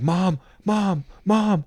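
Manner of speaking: mock screaming for mom. Dying man screams for his mother before Joel kills him. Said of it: "he's screaming that out loud".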